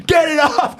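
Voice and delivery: high-pitched mock panicked scream; hammed-up cry for help; retold scream. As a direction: shriek it as if a hawk is on your arm. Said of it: "I'm screaming".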